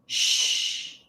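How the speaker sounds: quick unvoiced shh; soft shush. Shown as a demonstration. Teacher counts the class into the shushes, soft. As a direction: shush someone gently. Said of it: "So we'll do twenty".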